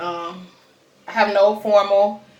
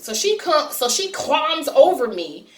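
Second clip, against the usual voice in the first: ordinary delivery, nothing marked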